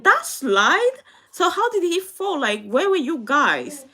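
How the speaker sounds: high, loud shocked questions; stunned at the school's news; retold shock, voice jumping up; shocked disbelief. Retelling shocked reaction to school.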